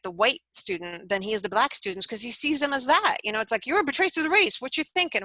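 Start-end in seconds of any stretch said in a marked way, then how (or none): 3.7-5.2 raised, indignant accusing voice; angry accusation. Retold or imitated. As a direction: act out a man scolding a traitor. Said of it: "growing angrier and angrier".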